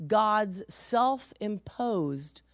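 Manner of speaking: very slow, each word spaced; preacher stressing his key point; slow emphasis. Real, not acted. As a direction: spell the point out slowly. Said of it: "Very important for you to know that".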